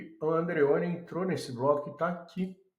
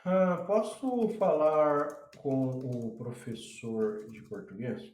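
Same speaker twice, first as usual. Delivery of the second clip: slow typing-along; slower than usual. Real, not acted. Teacher typing a request for the Portuguese professor, slow typing-along. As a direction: sound it out slowly as you type. Typing out his chat message.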